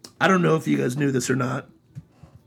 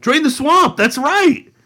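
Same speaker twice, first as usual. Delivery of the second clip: mock rally shout; 9 dB louder, slower than usual. Chanting Trump slogan.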